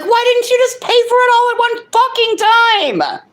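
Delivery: retelling losing it at the gas station clerk, shrill and loud; exasperated outburst. Retold or imitated. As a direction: shriek it like you've lost it. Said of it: "I'm like".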